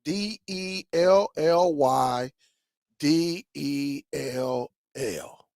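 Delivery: slow, letter by letter spelling; spelled out slowly. Shown as a demonstration. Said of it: "My name on there is [name]".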